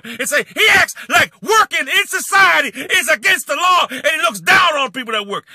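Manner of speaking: loud, high furious rant; angry shouting. Real, not acted. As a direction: yell it at him with contempt. Ranting at rival YouTuber.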